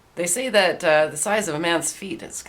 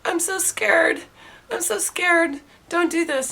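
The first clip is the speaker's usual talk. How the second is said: frightened woman; 12 semitones higher, slower than usual. Retold or imitated. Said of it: "she's shivering and she's quaking".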